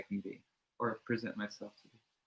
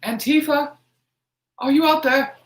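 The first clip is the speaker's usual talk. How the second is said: much higher, much louder mock shout into the dark; mock call outside; 15 dB louder than usual. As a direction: yell out to imaginary Antifa outside. Calling out to imagined intruders.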